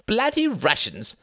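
scowling outburst. Acted. Sam scowling about the Russians on the plane. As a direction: spit it out with a scowl. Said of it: "scowled Sam".

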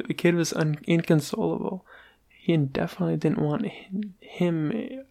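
ordinary delivery, nothing marked

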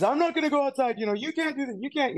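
very high-pitched panicky refusal; high, protesting put-on voice; mock panic. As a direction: imitate a man starting to freak out. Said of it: "he's going into full on panic mode".

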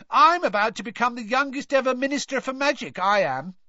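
high, loud cockney brag; boastful Stan. Acted. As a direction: puff yourself up for the Veela. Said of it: "Stan boasts to Veela".

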